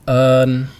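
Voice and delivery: un with the sounds stretched slowly; teacher drawing out un slowly for learners; slow drawn-out un. Shown as a demonstration. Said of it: "Let's look at some other pairs".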